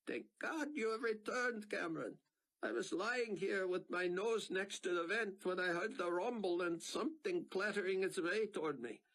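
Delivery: high, strained trapped man; pleading, relieved then desperate; Sion's voice. A narrator's reading. Trapped man begging Buck to free him, strained. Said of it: "a faint, breathy voice".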